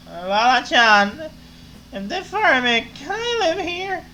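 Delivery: slow, pleading tipsy whine; begging the bartender, half drunk. Acted. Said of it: "to make the drunk voice".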